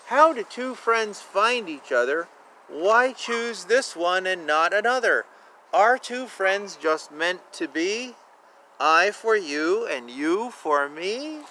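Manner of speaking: gentle sing-song picture book read; lilting storybook narration; reading aloud. Acted. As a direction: read it like a bedtime rhyme for kids.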